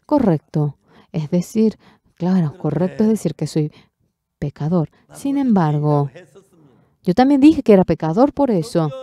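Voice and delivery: ordinary talk, nothing marked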